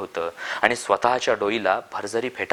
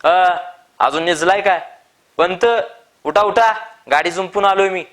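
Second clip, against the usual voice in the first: higher, louder call to wake him; 8 semitones higher, 6 dB louder than usual. A narrator's reading. Farmer calling Appa to wake up, loud higher shout. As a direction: shout through the shut door. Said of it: "दारावर ठाप टाकून म्हणाला".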